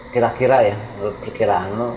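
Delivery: ordinary delivery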